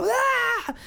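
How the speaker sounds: short high mock yell; imitated scream. Bandmate shrieking at the TV, high mock yell. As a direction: shriek once in panic. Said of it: "Kwan's going".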